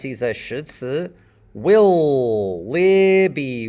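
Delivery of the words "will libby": "Will" and "Libby" stretched on the same short i; matching vowels. Shown as a demonstration. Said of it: "about the same vowel".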